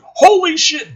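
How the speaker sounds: high, loud startled yell; filtered pilot yelp. Acted. Pilot seeing the alien ship, high startled yell. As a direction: shout in sudden alarm over the radio. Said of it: "Radio voice one, filtered".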